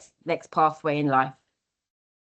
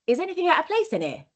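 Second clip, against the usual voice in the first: high, quick playful imitation of visiting friends; friends' mock question; 11 semitones higher, faster than usual. Friends visiting her house, checking for mess, high and quick. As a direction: toss it off fast and high like a curious friend. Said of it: "they're like".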